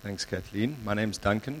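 usual speaking voice

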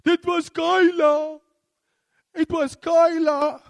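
put-on high, slow whiny little boy sob; crying child. Small son crying to his mother, slow high wail. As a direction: whimper it out like a tearful kid blaming his sister. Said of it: "he's crying".

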